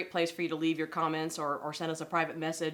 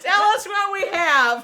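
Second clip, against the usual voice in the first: laughing; 11 semitones higher, 11 dB louder, slower than usual. Real, not acted. Game show joke, both laughing.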